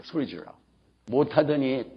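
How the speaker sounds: ordinary talk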